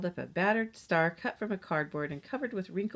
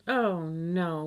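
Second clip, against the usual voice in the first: mild wail; 4 dB louder than usual. A narrator's reading. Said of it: "wailed Molly".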